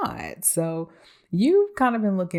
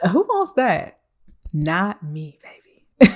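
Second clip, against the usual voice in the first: sassy; 3 semitones higher, 6 dB louder, slower than usual. Acted. Mocking the idea of wasting emotions, sassy. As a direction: toss it off with sass.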